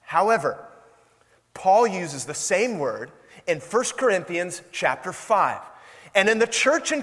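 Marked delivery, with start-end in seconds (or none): none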